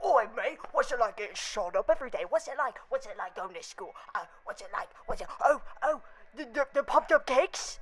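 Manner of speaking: hammed-up British accent, rapid and stumbling; exaggerated British banter, tripping over the words; mock British retort. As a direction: sputter back as an indignant cartoon Brit. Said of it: "make a joke to a British person".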